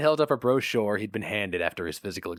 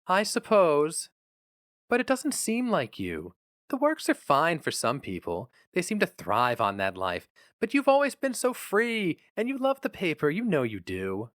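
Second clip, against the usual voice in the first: lightly voiced wife, higher and slower, wistful; 8 semitones higher than usual. A narrator's reading. Nan sighing over her husband leaving his paper, wistful. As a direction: sigh it out, a touch regretful.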